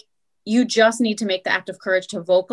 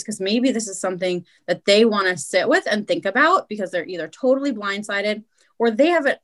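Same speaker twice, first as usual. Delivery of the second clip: ordinary talk, nothing marked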